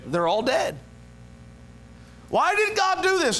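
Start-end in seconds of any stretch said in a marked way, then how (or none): 2.3-3.4 exclaimed question. Acted. Preacher cries out question.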